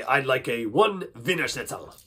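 put-on German accent. Shown as a demonstration. Accent warm-up where we say it, German accent. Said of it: "How about German".

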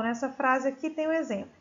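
ordinary talk

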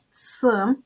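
"Ц" as a bare hard ts sound; isolated consonant sound. Shown as a demonstration. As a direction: sound out "Ц" as hard ts, not the letter name. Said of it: "Називаємо приголосні звуки".

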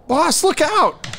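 high, shrill-free panicked cry; panicked high warning. Acted. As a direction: yell a frantic warning up high. NPC shouts warning at boss.

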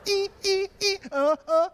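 very high screeching then panicked grunts; imitated score and terrified viewer; screech and gasps. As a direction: mix horror strings with gasps.

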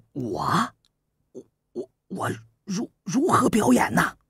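a timid youth too anxious to get the words out; nervous stammer. Acted. The frightened prince asks Su Qin how to act, stammering. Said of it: "公子快忐忑道".

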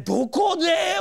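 sudden high shocked squeak; panicked disbelief, voice jumps up; surprised. Retold or imitated. At the register, realizing the wallet is gone, shocked. Finding his wallet missing.

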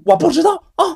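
high, impatient brush-off. Acted. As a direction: push him off onto the manager. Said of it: "就有点不耐烦了".

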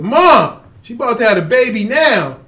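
high, loud urgent call to her mother; husband calling the mother upstairs, loud and high. Retold or imitated. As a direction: shout upstairs to Mom, urgent. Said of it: "So I said".

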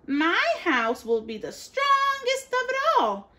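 high, proud piggy boast; boastful little pig. A narrator's reading. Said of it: "she boasted".

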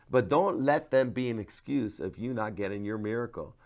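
ordinary delivery

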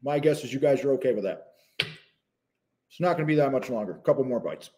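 talking through a mouthful of protein bar; words round a bite of food; mouth full. Real, not acted. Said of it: "talking with my mouth full".

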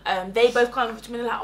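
ordinary talk

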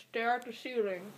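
mumbled through full cheeks; cheeks stuffed full. Real, not acted. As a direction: mouth still full. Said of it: "spit these out".

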